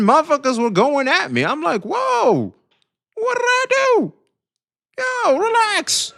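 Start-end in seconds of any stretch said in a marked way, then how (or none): 1.8-6.1 high-pitched protest, long drawn words; mock alarm. Streamer retelling how chat went at him, high mock alarm. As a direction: act out baffled innocence way up high. Retells reacting to being attacked.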